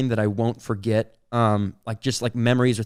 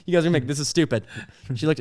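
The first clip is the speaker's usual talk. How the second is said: usual speaking voice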